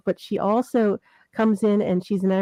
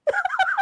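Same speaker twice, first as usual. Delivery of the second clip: very high peal of laughter. Real, not acted. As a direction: shriek with laughter at the question. Laughing at question about being thinner.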